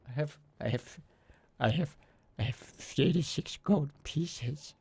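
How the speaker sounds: slow, secretive whisper; whispered bribe. Acted. Intruder whispering a bribe offer to the guard. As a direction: slip the offer in a hushed whisper.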